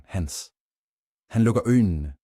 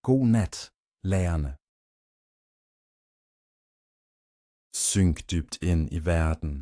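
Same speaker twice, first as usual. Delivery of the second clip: calm guided bedtime meditation. A narrator's reading. Narrator guiding the listener down into sleep, calm meditation voice. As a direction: ease the listener gently towards sleep.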